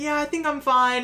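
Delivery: high put-on kid voice; boy reassuring. Fallen boy answering the mouse, high and light. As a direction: pitch it up like a little boy. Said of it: "And he's like".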